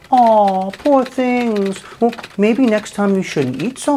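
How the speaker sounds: high, cooing fake sympathy; mock pity. Mocking the banks with fake pity. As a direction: coo over them like sad babies.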